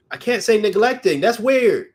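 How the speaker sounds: high, loud, laughing disbelief; freaking out. Real, not acted. Reader who can't pronounce a word, freaking out in disbelief. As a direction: exclaim it in amused alarm. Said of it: "I'm freaking the hell out".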